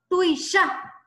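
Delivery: "Tuishá" with the final á hit hard; teacher showing "Tuishá" stressed on its final vowel; stress on last syllable. Shown as a demonstration. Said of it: "Dónde se acentúa? En la última A".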